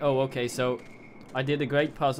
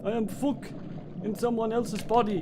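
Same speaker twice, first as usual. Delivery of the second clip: Klingon voice; 9 semitones higher than usual. Acted. Streamer playing the Klingon named Fuck, high Klingon voice. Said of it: "fuck the Klingon".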